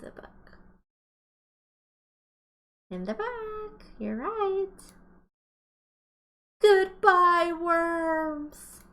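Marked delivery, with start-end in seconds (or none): 2.9-4.7 cheery praise. Acted. Praising pupils' answer. 6.6-8.8 much louder, bright farewell call; a big singsong goodbye to the worms; cheery goodbye. Acted.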